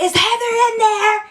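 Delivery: high-pitched call through a door. Retold or imitated. She runs to the door, calling out. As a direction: call urgently for your missing friend.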